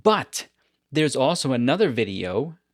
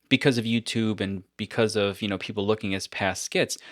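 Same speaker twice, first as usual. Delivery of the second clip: ordinary delivery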